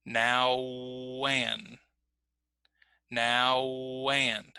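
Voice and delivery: NOW AND joined by a long w glide; linked glide. Shown as a demonstration. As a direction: draw "Now" out through a w into "and". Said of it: "use one of these glide sounds".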